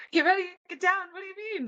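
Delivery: very high, bewildered repeat of the order; panicked echo. Retold or imitated. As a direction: squeak it back in confused panic. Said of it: "panicking in my head".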